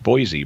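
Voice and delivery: put-on "Boise" as an outsider reads it, BOY-zee. Shown as a demonstration. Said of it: "it should be pronounced Boise".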